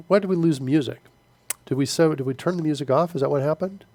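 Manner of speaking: normal talking voice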